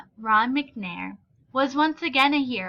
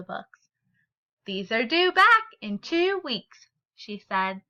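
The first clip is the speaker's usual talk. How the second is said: story reading. A narrator's reading. Teacher reads picture book aloud.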